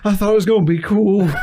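high-pitched mock-pitiful excuse; whimpering buddy imitation. Hurt buddy explains his failed dive, mock whimper. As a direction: plead it in a high, pained voice.

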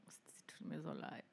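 hushed, sorry for her; pitying whisper. Real, not acted. Co-host whispering pity for [name], hushed.

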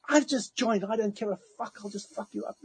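high-pitched threat, fading in volume; mock angry threat. The man threatening him before the staff, mock-angry. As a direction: imitate a belligerent man, high and softening. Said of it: "he did it so angrily".

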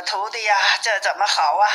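usual speaking voice

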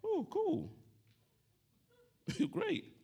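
high, offhand mock indifference; the other nine lepers, lightly unbothered; unimpressed nine. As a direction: shrug it off lightly, almost bored. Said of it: "I could imagine the other nine".

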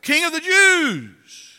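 high, loud, drawn-out jeer; mocking yell. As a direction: yell it like soldiers hailing a fake king.

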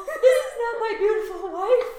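a friend echoing the rant in a laughing yell; mock shout. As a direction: belt it out as a parody. Mocking the king's rant.

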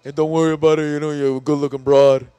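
loud, put-on movie tough-guy voice; Stallone impression. Movie star shakes the driver's hand, loud impression. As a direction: reassure her as a big action star. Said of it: "and he was like".

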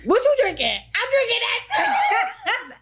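high-pitched, hammed-up eager squeal; mocking friend imitation. Teasing a friend about making friends on trips, mocking squeal. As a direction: mimic an overexcited friend, drawing it out high. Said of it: "You be like".

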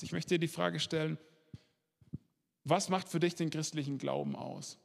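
ordinary talk, nothing marked

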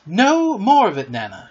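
loud, high scolding, dropping on the name; sharp telling-off; stern. A narrator's reading. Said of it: "she said sternly".

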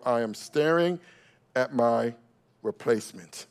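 a king's dread muttered to himself; inner voice. Retold or imitated. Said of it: "he said to himself".

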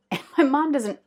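ordinary talk

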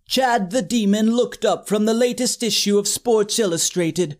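ordinary talk, nothing marked

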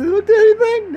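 very high, loud mock squeal; squeaky customer. Customer squealing a question about the garbage can.

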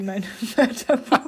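giggling through the words; trying not to laugh; stifled giggles. Real, not acted. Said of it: "nicht lachen, nicht lachen".

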